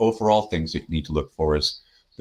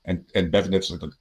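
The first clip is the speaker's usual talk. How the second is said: ordinary delivery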